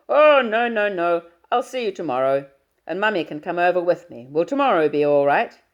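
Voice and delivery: booming fatherly hello down the line. Acted.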